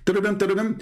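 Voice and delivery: ringtone sung out with the mouth; mock phone ring; imitated phone ringing. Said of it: "See how fast the ringing?".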